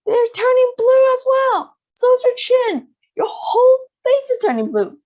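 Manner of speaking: high, loud panicked mother voice; frantic mother. A narrator's reading. Mother cries out as daughter turns blue, high and loud.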